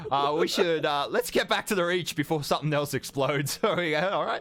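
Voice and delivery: high, chatty character voice; Kenna. Acted. Kenna herding the party back to the Reach, high-pitched. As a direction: hurry the group along, pitched high.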